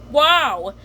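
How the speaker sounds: an impressed wow; Grouper amazed. Retold or imitated. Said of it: "Mister Grouper says".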